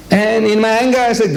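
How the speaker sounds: high-pitched, emphatic lead-in; heated preaching. Retold or imitated. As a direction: raise the pitch into a mock outburst. Preacher re-enacting his angry outburst.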